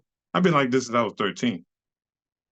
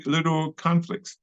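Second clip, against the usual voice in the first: ordinary delivery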